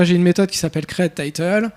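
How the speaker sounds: usual speaking voice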